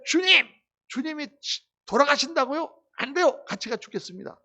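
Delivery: high, loud cry of a zealous disciple; impassioned plea. Retold or imitated. Peter pleading with Jesus, high and loud. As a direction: beg the Lord not to die, rising high. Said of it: "막 이러고".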